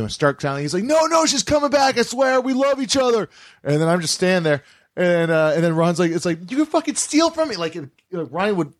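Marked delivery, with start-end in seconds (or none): 0.8-3.3 high-pitched frantic insistence; imitating a panicked, pleading thief; desperate pleading. Thief pleads girlfriend will return. 6.5-7.5 imitating an outraged store owner; wounded outrage. Robbed owner confronts the thief.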